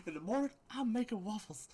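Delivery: high, animated talking donkey voice; Eddie Murphy donkey. Acted. Game master voices the waffle-making donkey, Eddie Murphy impression. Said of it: "in an Eddie Murphy voice".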